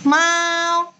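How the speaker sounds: "Mau" high, long and level; first tone. Shown as a demonstration. Teacher drilling Dina on tone one, high level "Mau". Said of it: "nada satu ya".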